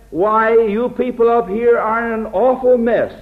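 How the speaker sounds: a blunt know-it-all rebuke; brash scolding. Pretend. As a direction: scold them like a bossy outsider. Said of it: "a man of God doesn't talk like that".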